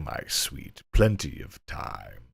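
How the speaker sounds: hammy deep leer, Plenty of time. Acted. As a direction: drop low and slow on "Plenty of time" like a seducer. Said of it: "inappropriate voice I can muster".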